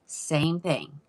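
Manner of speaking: usual speaking voice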